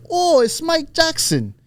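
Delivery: high, drawn-out Irish exclamation; slow, high mock Irish greeting; imitated Irish accent. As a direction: mimic an excited Irish fan. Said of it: "I all hear the Irish accents".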